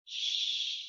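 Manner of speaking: long hissed sh, no voice; a quiet shushing sh; sh sound. Shown as a demonstration. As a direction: push air through rounded lips, sh. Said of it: "they spell the sound".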